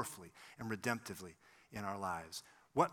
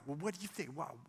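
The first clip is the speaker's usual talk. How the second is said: quick, higher-pitched imitation of nasty scorn; 5 semitones higher, 3 dB quieter, faster than usual. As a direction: imitate a nasty, dismissive retort. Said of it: "kind of in a nasty tone".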